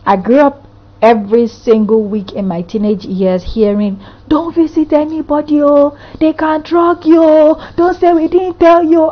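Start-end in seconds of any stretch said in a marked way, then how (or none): 4.3-9.1 high, loud mock scolding; nagging elder's warning. Elders warning a teenage girl about visiting, nagging.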